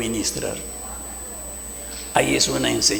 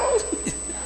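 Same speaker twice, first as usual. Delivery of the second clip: high unvoiced laugh; 6 dB quieter than usual. Pastor overcome with emotion.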